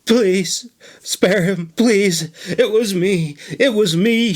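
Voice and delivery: high, tearful wail. Acted. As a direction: wail it out, begging for mercy. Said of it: "she wailed".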